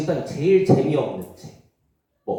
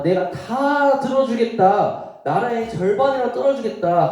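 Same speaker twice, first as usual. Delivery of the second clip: generous king; 7 semitones higher, 3 dB louder than usual. Acted. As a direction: promise half the kingdom with a flourish. King promises Esther. (높고 크게 너그럽게 약속하는 왕)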